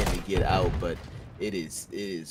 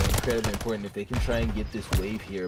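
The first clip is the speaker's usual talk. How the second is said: ordinary talk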